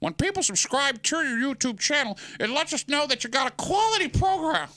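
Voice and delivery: high, hammed-up corporate voice; mock a stiff corporate boss; corporate guy voice. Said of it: "the folks that work out of town above us go".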